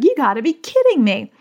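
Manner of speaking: mama bear exasperation; exasperated outburst. Retold or imitated. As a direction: blurt it out in disbelief. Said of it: "I was just like".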